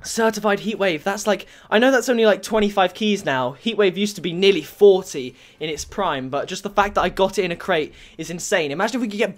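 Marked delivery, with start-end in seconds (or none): none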